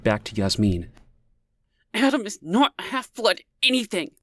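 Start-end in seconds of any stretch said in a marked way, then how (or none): 1.9-4.1 through clenched teeth. A narrator's reading. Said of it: "she said through clenched teeth".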